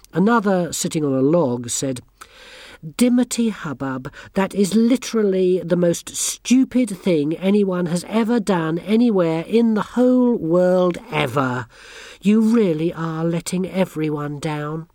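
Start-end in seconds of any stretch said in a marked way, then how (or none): 3.0-14.9 high, haughty schoolgirl scolding; bossy girl scolding. A narrator's reading. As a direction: talk down to her like a little queen. Anagramma scolds Dimity.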